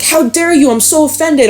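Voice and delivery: high-pitched mock indignation; mock offended commenter. Viewer in the comments protesting the reading, mock offended. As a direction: play the huffy commenter, pitched well up. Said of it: "someone went in the comments and was like".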